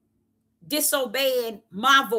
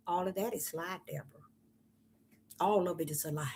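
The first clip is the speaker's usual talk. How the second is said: calm voice; 6 semitones lower, 12 dB quieter, faster than usual. Retold or imitated. Preacher retelling the Father's words, calm and hushed. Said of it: "he said it with such a, a calm voice".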